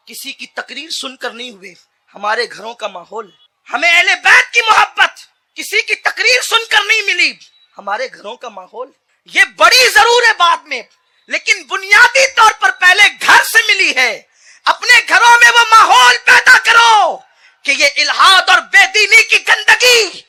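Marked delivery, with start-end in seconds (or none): 3.7-5.1 high, loud fiery sermon; preaching at full force. Acted. Preacher urging parents, roaring high and loud. 5.6-7.4 higher, louder preaching of तकरीर; raised preaching repeat. Shown as a demonstration. Preacher repeating his point to the crowd, louder. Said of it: "repeated louder". 7.8-20.2 high, loud fiery sermon; preaching at full force. Acted. Preacher urging parents, roaring high and loud.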